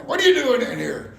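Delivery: big man's loud, high-pitched yell; yelled challenge. Retold or imitated. Factory boss confronts a stranger, yelling. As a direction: holler it at an intruder. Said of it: "he starts yelling".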